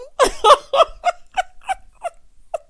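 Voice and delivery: loud shrieking cackle; high shrieking laugh. Real, not acted. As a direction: scream with laughter. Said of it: "I hollered so loud".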